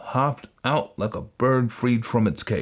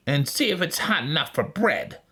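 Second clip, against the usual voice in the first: witch; 6 semitones higher than usual. A narrator's reading. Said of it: "said the witch".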